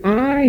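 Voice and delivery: "I" lifted high and set apart; preacher stressing God's own "I"; emphatic I. Shown as a demonstration. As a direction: hit the word "I" hard and high. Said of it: "here is very emphatic".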